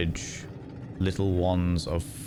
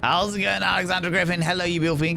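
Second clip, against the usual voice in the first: high, bubbly hello to a viewer; excited greeting; 11 semitones higher, 4 dB louder, much faster than usual. Real, not acted. Streamer welcomes Alexander Griffin to the chat, excited greeting. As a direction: greet them in a big high-pitched voice.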